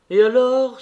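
high, loud, eager cry; girl's exclamation. A narrator's reading. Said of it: "s'écria une des jeunes filles". (exclamation vive, haute et forte)

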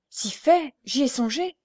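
prompt, high-pitched admission; unhesitating. A narrator's reading. Blind woman answering Pierre, unhesitating. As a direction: answer at once, pitch lifted. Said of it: "Sans hésitation".

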